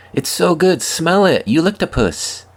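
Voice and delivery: put-on high, excited kid urging dad; eager child. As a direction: urge dad like an excited nine-year-old. Nine-year-old quoted insisting.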